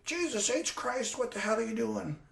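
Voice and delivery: exasperated dad. Retold or imitated. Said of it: "He says".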